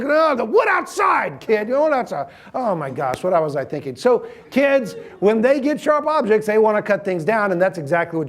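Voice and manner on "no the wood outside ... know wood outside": exasperated dad. Retold or imitated. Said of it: "And it's like".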